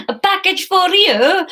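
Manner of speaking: put-on high, loud Spanish accent sliding into Italian; attempted Spanish accent. Courier at the door, botched Spanish accent. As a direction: overdo a Spanish accent till it turns Italian. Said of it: "this is Italian, isn't it?".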